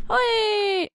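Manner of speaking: drawn-out high "whee"; long squeal. Real, not acted. Player tumbling in the game, long squeal. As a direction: squeal and hold it.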